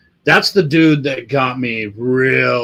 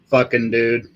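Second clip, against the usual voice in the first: normal talking voice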